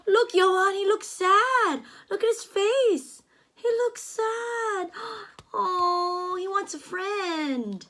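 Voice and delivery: ordinary delivery, nothing marked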